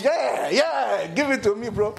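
high, hyped cheer egging the evangelist on. Retold or imitated. Preacher reliving cheering on the evangelist, hyped up. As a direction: cheer like watching your side win the debate. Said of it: "see the way I was".

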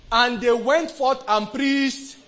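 slow, high, very loud scripture reading; shouted proclamation. Real, not acted. Preacher proclaiming the verse to the congregation, slow building shout.

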